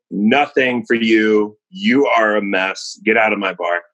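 slow, firm refusal; slow stern bartender. Acted. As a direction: lay down the law, slowly. Bartender throwing out drunk.

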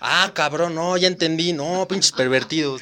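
loud, pushy street-interviewer voice; playing a loud YouTuber teasing a couple at the pool; Chiquirulo impression. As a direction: hype it up like a loud vlogger grilling strangers. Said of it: "me hubiera encantado ver al Chiquiculo hacer dinámicas ahí".

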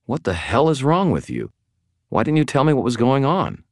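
high, exasperated questioning; angry demand. A narrator's reading. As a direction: demand answers, indignant. Confronting Thomas angrily over secrecy.

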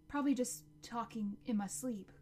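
usual speaking voice

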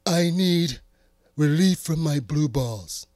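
loud Trump impression. Demanding relief from his watch, loud Trump impression. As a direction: boom it like Trump at a rally. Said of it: "in my Trump voice".

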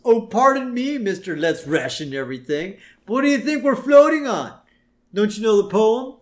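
hammed-up sarcastic retort; Homer's sarcasm. Co-host voicing a castaway mocking water rationing, sarcastic. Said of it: "And Homer says".

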